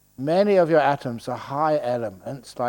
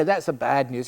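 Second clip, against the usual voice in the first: normal talking voice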